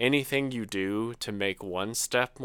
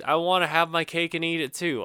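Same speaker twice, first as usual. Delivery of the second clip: ordinary talk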